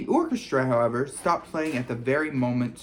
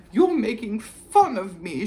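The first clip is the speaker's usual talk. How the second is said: high, louder hurt accusation; tearful; 9 semitones higher, 6 dB louder than usual. A narrator's reading. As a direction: close to tears, accusing. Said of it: "tears welling rapidly".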